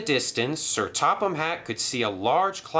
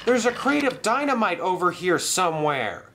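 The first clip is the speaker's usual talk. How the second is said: shouted warning; 11 semitones higher than usual. Acted. Thomas warns Cranky about dynamite, shouting. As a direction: warn Cranky of danger. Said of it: "shouted Thomas".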